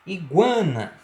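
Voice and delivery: ordinary delivery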